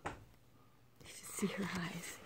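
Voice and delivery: whispered aside, slow. Real, not acted. Aside to the other adult about the child, whispered.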